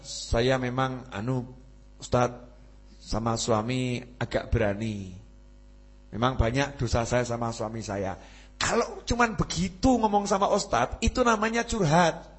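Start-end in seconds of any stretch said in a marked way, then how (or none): 0.1-8.1 sheepish confession. Retold or imitated. Wife confessing her faults to the Ustadz, softly. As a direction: murmur it like an embarrassed wife confessing. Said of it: "ibu-ibu bilang".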